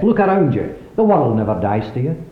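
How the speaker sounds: ordinary talk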